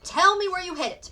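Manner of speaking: angry father demands the watch; demanded. A narrator's reading. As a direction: bark the order at the boy. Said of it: "Mister Friedrich demanded".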